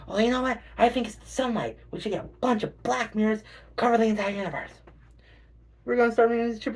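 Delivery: high, eager crackpot plan; mock schemer. A crank planner covering the universe in mirrors, high and proud.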